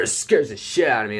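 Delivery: ordinary talk, nothing marked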